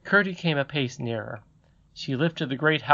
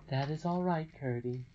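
soft, gentle reassurance; calm him tenderly; reassuring lady; 2 semitones higher, 8 dB quieter than usual. Acted.

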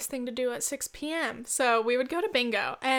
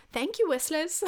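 higher mock-British bingo caller; terrible British accent; 5 semitones higher than usual. Caller thanking the whistlers, terrible British accent. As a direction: thank the crowd in bad British. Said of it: "this is a terrible British accent".

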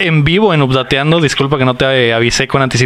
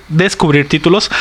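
usual speaking voice

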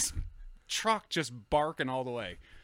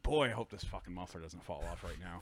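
retold low, softer worried mutter; muttered inner thought; 7 semitones lower, 6 dB quieter than usual. Driver to himself with police behind him, low mutter. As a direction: grumble it low under your breath.

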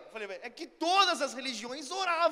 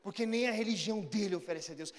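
ordinary talk, nothing marked

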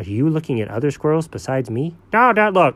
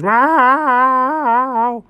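plaintive meow; 8 semitones higher than usual. Retold or imitated. Cat answers at vet.

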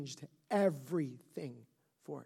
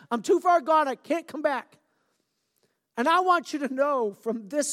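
loud, higher, urgent preaching; preaching at full force; 9 semitones higher, 8 dB louder than usual. Acted. Pastor pleading with wanderers from God, loud fervent preaching.

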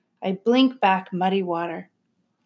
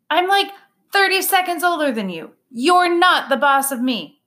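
indignant little sister; 7 semitones higher, 5 dB louder than usual. A narrator's reading. Younger sister bristles at brother.

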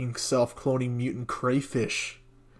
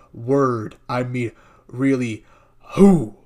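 mock outrage; 9 dB louder, slower than usual. Reading an outraged tweet aloud.

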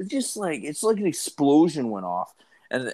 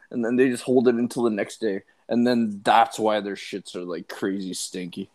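ordinary talk, nothing marked

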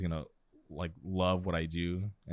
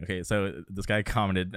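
ordinary delivery

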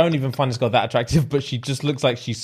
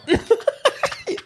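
high laugh. Real, not acted. Laughing at the joke.

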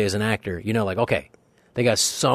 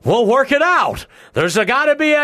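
much higher, louder fretful voice; worried producer; 7 dB louder than usual. Retold or imitated. Producer worried about delays, high fretful voice. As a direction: soothe everyone in a panic.

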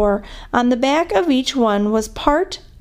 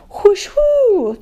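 high, voiced swoosh of a flying puck; puck whoosh noise; 10 semitones higher, 3 dB louder than usual. A narrator's reading. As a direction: imitate a puck whooshing past. Imitated sound of air hockey puck.

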